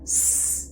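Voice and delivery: a long hissed "س"; S sound alone. Shown as a demonstration. Teacher demonstrating the letter S for learners, long isolated s. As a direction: hold "س" as one drawn-out voiceless s. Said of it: "الاس لوحده صوته".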